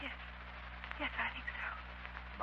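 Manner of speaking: whisper. Acted. Hushed while hearing telepathic voice.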